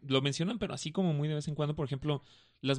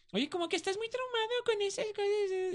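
high-pitched mimicry of a nagging girlfriend; mock girlfriend voice. Man retelling his ex's complaint, high mocking voice. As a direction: imitate her complaint way up high.

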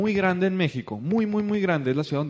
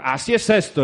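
louder, pushy mock insistence; aggressive, retold; 5 dB louder than usual. Organiser answering people who balk at the price, aggressively. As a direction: cut off complaints with a firm push. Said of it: "Uy, qué agresivo".

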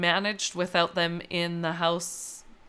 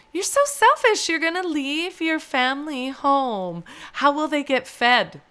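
imitating the nail tech, much higher, half-teasing; mock scolding; 6 semitones higher than usual. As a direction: playfully voice the scandalized nail tech, pitched up. Mimicking the nail tech's scolding.

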